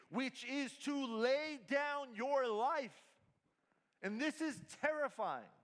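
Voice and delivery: high, loud, driving sermon delivery; impassioned preaching. Acted. Pastor urging the congregation to truly love Jesus, full-force preaching. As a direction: preach it full force, stretching key phrases slowly.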